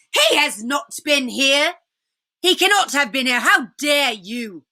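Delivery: a hint of a raging queen; lightly voiced furious witch. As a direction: witch refusing to believe the fox. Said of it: "roared the witch".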